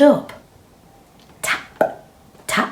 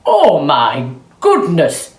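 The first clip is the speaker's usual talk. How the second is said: loud excited farmer voice; farmer crying out; 5 dB louder than usual. Acted. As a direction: exclaim like an amazed farmer. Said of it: "he cried".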